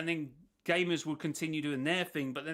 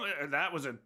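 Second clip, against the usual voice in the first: usual speaking voice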